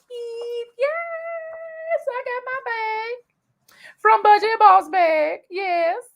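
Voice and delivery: high, squealing excitement over the gift; high-pitched excited unboxing squeal. Real, not acted.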